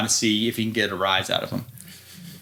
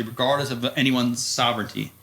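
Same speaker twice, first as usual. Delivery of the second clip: normal talking voice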